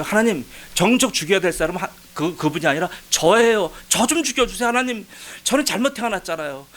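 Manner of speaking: high-pitched, indignant pleading prayer; retold railing at God. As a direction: argue with God in a rising, aggrieved voice. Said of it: "목청 높여 떠들었어요". (하나님께 따지듯 목청 높여 항변)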